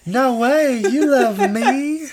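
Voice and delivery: high, slow syrupy Southern drawl; Southern belle. Retold or imitated. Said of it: "A little old Southern belle?".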